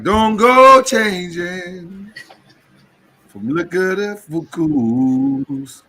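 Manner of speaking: very slow, stretched complaint; slow drawn-out quote. Retold or imitated. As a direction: drag every word out. Acting out regulars' complaint.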